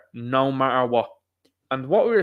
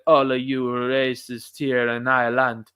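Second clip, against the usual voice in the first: slow, put-on Jamaican accent; mock a Jamaican streamer, slow and deliberate; slower than usual. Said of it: "I'm very impressed with myself".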